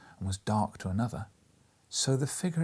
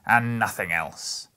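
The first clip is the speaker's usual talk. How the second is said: slow and louder, savouring the win; triumphant, slow; 5 dB louder, slower than usual. Acted. Scrooge sure no ghost will come, slow triumphant. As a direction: draw it out in triumph. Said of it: "said Scrooge triumphantly".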